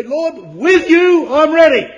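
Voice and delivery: bold, ringing loud declaration; Peter's cocksure, emphatic boast; full confidence. Acted. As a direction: proclaim it high and slow, standing tall. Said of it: "looking at the Lord in full confidence".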